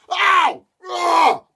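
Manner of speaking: high, loud yelps of pain. A narrator's reading. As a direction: yelp as she hits the bridge. Demon slips and falls.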